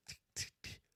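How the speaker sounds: three voiceless, soft ticks; imitated dull taps. Showing the lieutenant a weak spot, soft imitated ticks. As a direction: mimic faint hammer ticks, barely sounded. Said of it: "Hear this?".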